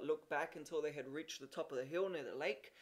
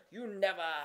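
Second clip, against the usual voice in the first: plain reading voice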